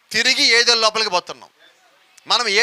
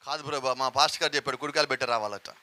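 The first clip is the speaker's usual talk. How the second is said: much lower and softer, sheepish; meek reply; 8 semitones lower, 9 dB quieter than usual. Retold or imitated. The woman excuses herself to Jesus, softer and lower. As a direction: mumble it like a caught-out woman.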